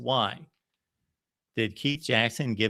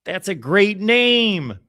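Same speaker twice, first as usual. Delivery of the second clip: excited friend; 8 semitones higher, 6 dB louder than usual. Retold or imitated. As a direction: sell him the name like a thrilled buddy. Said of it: "he finally said".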